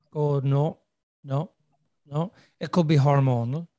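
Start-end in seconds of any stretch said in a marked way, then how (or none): none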